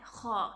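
خا said from deep in the throat, rough and fricative; throaty kh sound. Shown as a demonstration. Teacher showing students the throaty خا letter. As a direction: give a clear guttural خا. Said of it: "اس میں خا ہے".

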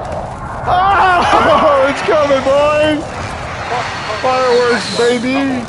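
high, loud hype shouting; excited yelling. Real, not acted. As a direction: yell it out, thrilled and panicking. Wild firefight, yelling to team.

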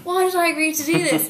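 nervous panic. Retold or imitated. Nervous actress regretting taking the role, high mock panic. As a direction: wail it in mock despair. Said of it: "I was like".

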